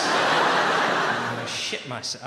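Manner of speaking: slow, loud panicked wail. Retold or imitated. Panic at sudden illness.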